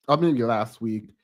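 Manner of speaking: ordinary delivery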